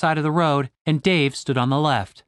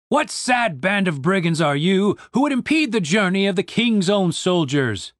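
a hint of a pompous guard; demanding soldier; 4 semitones higher than usual. A narrator's reading. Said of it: "demanded the soldier on the left".

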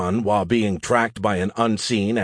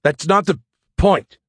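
higher, louder, halting through the cold; teeth chattering; 8 semitones higher, 5 dB louder than usual. Acted. Freezing pilot arguing with AI, chattering and halting. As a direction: force the words out, breaking before the last. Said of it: "I had trouble speaking as my teeth were chattering".

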